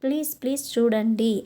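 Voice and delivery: ordinary delivery, nothing marked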